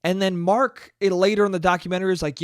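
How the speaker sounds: ordinary talk, nothing marked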